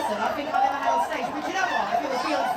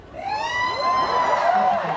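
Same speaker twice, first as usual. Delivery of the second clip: long, high, louder drawn-out okay; amused okay; 5 dB louder than usual. Real, not acted. Comedian trying on a fan's sunglasses, drawn-out high okay.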